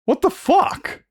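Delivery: very high-pitched disbelief; baffled, voice shooting right up; incredulous. Real, not acted. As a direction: squeak it out in sheer disbelief. Disbelief at the chat insult.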